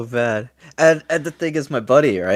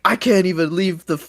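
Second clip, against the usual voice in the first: acted desperate protest; 11 semitones higher, 5 dB louder than usual. Jackie unable to leave the platform, mock desperate.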